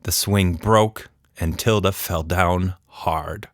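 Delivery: ordinary delivery, nothing marked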